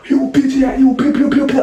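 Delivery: high-pitched gibberish cussing; mock cussing. Storyteller imitates the boy cussing at him, high mock gibberish. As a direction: squeal out garbled curses, imitating someone. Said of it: "He get to cussing".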